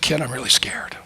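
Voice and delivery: hushed, frightened confession; scared whisper. Retold or imitated. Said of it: "He said".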